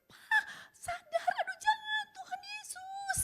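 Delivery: very high, loud, slow, sobbing plea; panicked scream. Retold or imitated. Wife begging her husband not to kill himself, sobbing scream. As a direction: scream at him to stop, crying. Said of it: "dia teriak".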